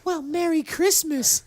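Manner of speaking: a sweet town lady welcoming them; high lady voice. Acted. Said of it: "she goes".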